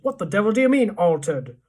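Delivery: loud, outraged demand; blustering, exasperated husband; shouted. A narrator's reading. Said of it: "shouted Mister Jenkins".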